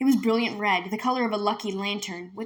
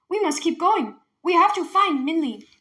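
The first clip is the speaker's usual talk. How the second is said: higher, louder, stubborn; angry insistence; 8 semitones higher, 7 dB louder than usual. Acted. Mother to father in the forest, angry insistence. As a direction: refuse to stop. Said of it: "pulled away angrily".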